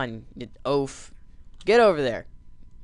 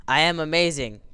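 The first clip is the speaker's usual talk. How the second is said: ordinary delivery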